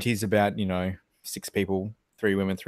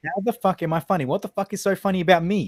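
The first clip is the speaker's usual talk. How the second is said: loud, higher, demanding; mock angry demand; 8 semitones higher, 5 dB louder than usual. Demanding the guy explain, loud and angry. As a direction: press him hard for an answer. Continuing mock gangster rant.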